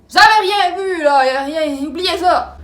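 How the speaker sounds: loud, high, urgent warning; stern warning. Retold or imitated. Father ordering the silent watchers to forget, loud warning. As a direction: order them to forget it, near yelling.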